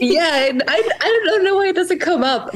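giggling embarrassed while explaining; laughing. Real, not acted.

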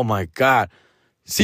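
usual speaking voice

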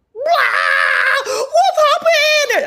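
very high, loud mock crying, vowels stretched out; hammed-up Jenny wail. Jenny when he gets back inside, hammed-up tearful wail. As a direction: wail it like an over-the-top sobbing woman. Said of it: "I don't know why I gave her that accent".

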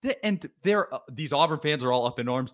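ordinary talk

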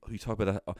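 usual speaking voice

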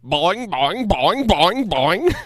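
high bouncy boing noises; springy cartoon bouncing sounds; bouncy springy boingy voice. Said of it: "Grimace ... going".

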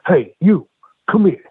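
mock angry call. Taz calling the new guy over, mock angry. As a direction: summon him like an angry veteran. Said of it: "here comes Taz".

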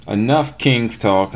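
ordinary talk

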